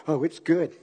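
soft, low, offhand cover-up; mock breezy denial. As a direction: play down the trouble, very softly. Acted cover-up about marriage.

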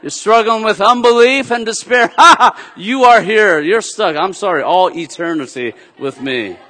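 laughing through a teasing God voice; playful and amused, lines broken by laughter; God's voice, laughing. Retold or imitated. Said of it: "He says".

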